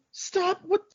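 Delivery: high, loud, frantic shouting; panicked yell. Retold or imitated. Beaten guy on his knees yells at his attacker, panicked. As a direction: yell it like he's being clubbed with a bar.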